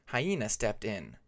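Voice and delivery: plain reading voice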